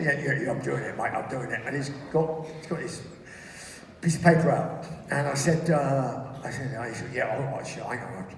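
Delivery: low, soft muttering to himself; absorbed, half-voiced fiddling with controls; preoccupied co-pilot. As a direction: mumble through a checklist, low and halting. Acting Nick mumbling through a checklist.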